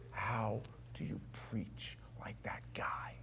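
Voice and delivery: slow, hushed stage whisper; awed whisper. Retold or imitated. Young men ask about admired preacher, awed whisper. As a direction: whisper it slowly, as if in awe.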